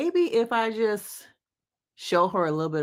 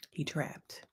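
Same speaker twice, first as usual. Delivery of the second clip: a hushed whispered repeat of "He trapped"; 4 semitones lower, 14 dB quieter than usual. Shown as a demonstration. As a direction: breathe it out, voiceless, as an aside. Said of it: "now he trapped".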